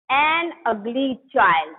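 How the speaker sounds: put-on an ugly child said very slowly word by word; slow clear example. Shown as a demonstration. Teacher dictating an example to students, slow and clear. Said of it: "अब देखिए, इसमें देखिए".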